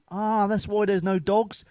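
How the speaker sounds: mate's slow knowing quip; deadpan joke. Retold or imitated. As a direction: drag out the pun slowly. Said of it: "my mate went".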